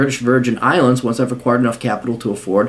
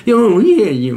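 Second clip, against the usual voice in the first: loud, high blubbering whine; hammed-up fake sobbing; mock crybaby; 6 semitones higher, 3 dB louder, slower than usual. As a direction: wail like a whiner, in mockery. Mocks whiner with fake crying.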